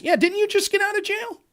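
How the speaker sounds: high-pitched incredulous retort; mock incredulous. Retort to someone calling others criminals, mock incredulous. Acted retort to a hypocritical complainer.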